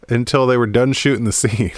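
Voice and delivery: normal talking voice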